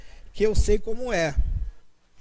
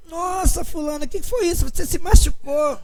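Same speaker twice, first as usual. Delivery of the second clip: startled concern; 6 semitones higher than usual. Retold or imitated. Ex-wife noticing the landlady's limp.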